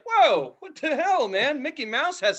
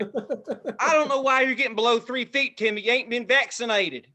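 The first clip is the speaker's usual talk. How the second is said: mock Southern. Mock Southern parent scolding Timmy.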